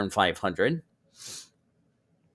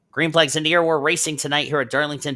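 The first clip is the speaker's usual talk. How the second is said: fast, loud green-flag call; excited race call; 6 semitones higher, 4 dB louder, faster than usual. Acted. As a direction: hype the start of the race.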